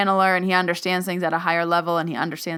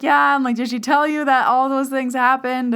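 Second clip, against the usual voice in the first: eager; 7 semitones higher, 5 dB louder than usual. Retold or imitated. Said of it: "And I was like".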